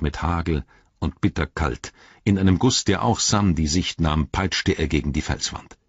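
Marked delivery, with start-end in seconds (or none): none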